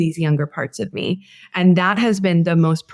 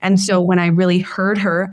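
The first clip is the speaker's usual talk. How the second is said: usual speaking voice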